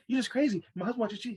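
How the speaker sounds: excited, high-pitched Haitian mom; high Haitian-accented impression of mom; strong Haitian accent. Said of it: "My mom has a strong Haitian accent".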